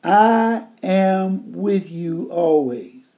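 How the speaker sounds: I slow and weighty, whole line at half pace; stress on I. Shown as a demonstration. Preacher pulling the verse apart for the congregation, slow and weighty on I. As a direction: dwell on I, deliberately slow. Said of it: "Let's take the I".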